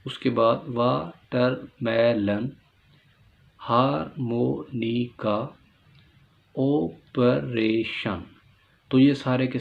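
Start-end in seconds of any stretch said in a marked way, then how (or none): 0.7-8.2 वाटरमेलन, हारमोनिका, ऑपरेशन each in four slow separate beats; four-part split. Shown as a demonstration. Teacher listing four-syllable words, each slowly split. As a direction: pause between syllables, as in हार, मो, नि, का for हारमोनिका. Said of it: "four syllable words होंगे".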